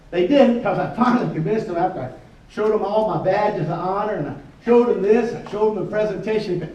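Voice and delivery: normal talking voice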